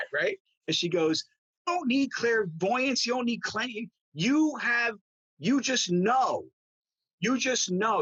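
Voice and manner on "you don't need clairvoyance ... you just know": high, slow, emphatic imitation of scolding; insistent mock yell, word by word; retold yelling. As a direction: drum it in, drawn out and pitched up. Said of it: "and she yelled at me".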